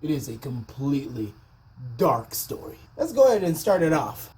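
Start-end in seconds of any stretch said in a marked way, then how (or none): 0.0-2.8 ominous low voice. Acted. Vlogger turns to viewers, low and ominous. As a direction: drop way down and soft, foreboding. Ominous turn after cheerful intro.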